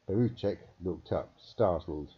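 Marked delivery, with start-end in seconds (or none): none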